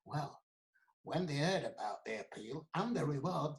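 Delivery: usual speaking voice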